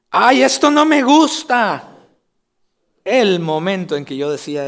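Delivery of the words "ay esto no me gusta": slow, higher and louder whine on "no"; whiny child complaint. Retold or imitated. Preacher imitating himself as a child rejecting food, slow whiny complaint. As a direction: complain about the food like a fussy kid. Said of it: "yo dije".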